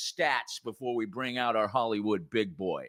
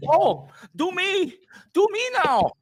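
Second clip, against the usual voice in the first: excited schoolchild; 7 dB louder than usual. Acted. Short actor begs the director for his turn, squealing like an excited kid. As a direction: beg like a kid bouncing for a turn. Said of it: "like an excited schoolchild".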